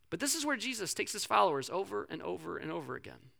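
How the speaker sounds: ordinary talk, nothing marked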